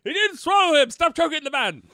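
high, loud put-on cry; shrieked comic alarm; alarmed protest. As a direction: shout in high mock panic to stop him. Plays alarmed bystander in the riff.

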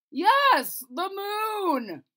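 king insisting to the Chamberlain, loud and high; insistent king. Acted. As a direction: lean hard on it like a stubborn king. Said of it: "said the king".

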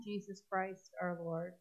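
usual speaking voice

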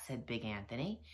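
ordinary delivery

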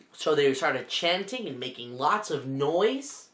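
plain reading voice

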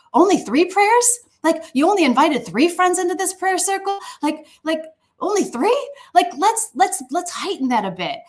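very high, lilting mock disbelief; playful incredulity. God coaxing believers to pray bigger, playful incredulity.

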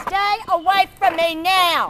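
slow, loud yelling; shout. Real, not acted. Woman ordering a kid away in a slow shout. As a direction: stretch it out, loud and high.

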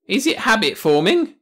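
high, loud worried question; anxious cry. Acted. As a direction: blurt it out anxiously. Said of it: "cried the High Cruv".

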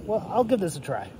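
normal talking voice

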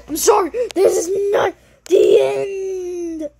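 hammed-up sobbing, high and loud; mock crying. Player fake-sobbing that the story is not over. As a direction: wail it out, slow and teary.

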